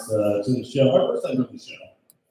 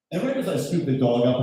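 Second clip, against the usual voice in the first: normal talking voice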